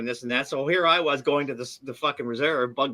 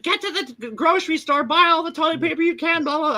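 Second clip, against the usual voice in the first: high, frantic imitation of his ex-wife; ex-wife yelling to buy toilet paper, mocked high; mimicking ex-wife; 14 semitones higher than usual. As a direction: squeak it out like a panicking wife. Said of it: "my ex-wife's calling me up".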